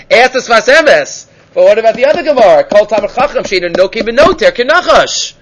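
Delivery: ordinary delivery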